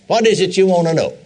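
ordinary talk, nothing marked

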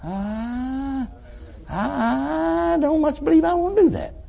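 high, drawn-out mock hesitation; reluctant refusal. Sinner balks at preacher's urging, high slow reluctance. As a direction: stretch the first word high, imitating a man balking.

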